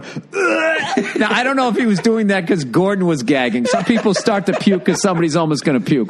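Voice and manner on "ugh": high mock retch; imitated gag. Boom guy gagging nine feet away, high mock retch. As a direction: gag like a queasy crewman. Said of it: "starts going".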